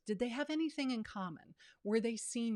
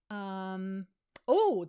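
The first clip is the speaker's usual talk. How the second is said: ordinary delivery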